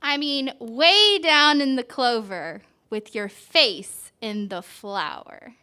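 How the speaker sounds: slow, gentle picture-book read; reading to a child. A narrator's reading. Parent reading a flower book to a child, gentle storytelling. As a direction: draw the child into the page.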